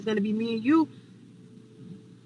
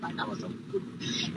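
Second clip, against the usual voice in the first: regretful whisper; 11 dB quieter than usual. Real, not acted. Storyteller admitting her own mistake, whispered. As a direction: voice dropped to almost nothing. Regretful aside, repeated under breath.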